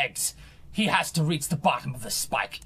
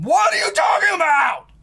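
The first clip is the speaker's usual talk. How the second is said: scream; 14 semitones higher, 14 dB louder than usual. Real, not acted. Denying to a commenter that he screams, mock outraged scream. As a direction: shriek it, much higher and louder. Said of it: "All you do is scream".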